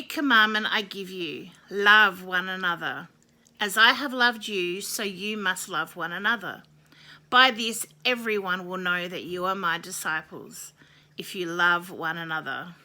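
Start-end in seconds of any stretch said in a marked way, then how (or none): none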